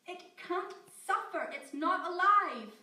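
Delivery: high, loud frantic protest; desperate shouting. Acted. Scientist Amy shouting denial at her captor. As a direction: insist desperately, near tears.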